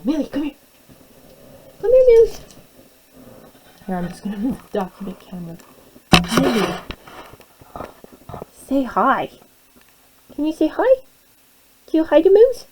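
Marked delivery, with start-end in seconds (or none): 0.0-2.4 high, sing-song dog voice; talking to a dog. Real, not acted. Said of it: "now you guys have heard my dog voice". 6.4-12.6 talking to a dog. Real, not acted. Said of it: "now you guys have heard my dog voice".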